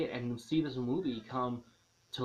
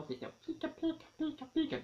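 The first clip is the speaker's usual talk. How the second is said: Pikachu voice; faster than usual. Pretend. Reviewer imitates Pikachu for viewers, squeaky cute. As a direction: chirp it like the cute yellow mouse.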